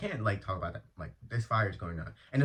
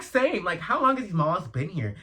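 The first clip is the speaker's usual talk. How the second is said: much higher and louder, eager; excited agreement; 7 semitones higher, 4 dB louder than usual. Retold or imitated. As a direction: jump in with thrilled agreement. Eager agreement retelling own reaction.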